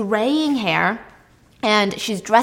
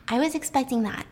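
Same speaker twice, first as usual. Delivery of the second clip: ordinary talk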